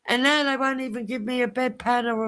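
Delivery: high-pitched tirade to an audience; mock indignant complaint. Acted complaint to the ward.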